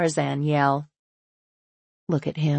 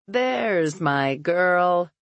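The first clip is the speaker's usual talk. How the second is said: crowing; 3 semitones higher, much slower than usual. Acted. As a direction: crow it out proudly. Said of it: "crows Thomas".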